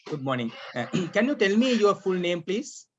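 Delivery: usual speaking voice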